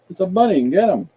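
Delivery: high, loud shout of discovery; excited city dweller. Acted. Space citizen spotting the bunny, loud high shout. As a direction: yell it out like a startled space citizen. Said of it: "look back at the bunny and go".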